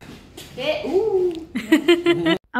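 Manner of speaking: bright high laugh. Real, not acted. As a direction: burst into a giggle. Laughing at friend in lab.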